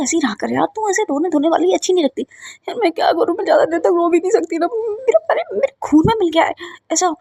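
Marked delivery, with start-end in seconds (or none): 2.8-7.1 higher, mock-tearful whine; a hammed-up teary whine; playful whine. Girl explains she can't cry.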